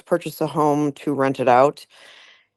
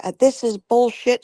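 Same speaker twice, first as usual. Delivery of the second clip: higher-pitched retold outburst; mild mock rant; 6 semitones higher than usual. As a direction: imitate the client's rant, lightly. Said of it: "she just kept ranting".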